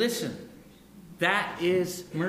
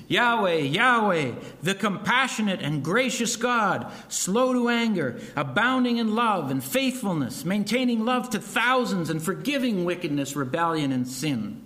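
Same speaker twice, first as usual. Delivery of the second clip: proclaiming; 4 semitones higher than usual. Acted. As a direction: declare each attribute like a herald. Preacher proclaims God's name.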